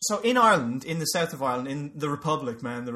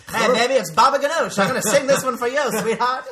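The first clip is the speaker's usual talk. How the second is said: high, loud, hammed-up crooner patter; Baba Ganoush lounge singer; 6 semitones higher, 8 dB louder than usual. Lounge singer dedicates a song to sweetheart, hammed-up. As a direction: schmooze the crowd like a cabaret act. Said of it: "lounge singer".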